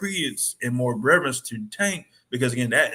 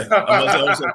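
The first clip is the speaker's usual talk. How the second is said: laughing; 6 semitones higher, 8 dB louder, much faster than usual. Real, not acted. Teasing about De La Hoya pictures.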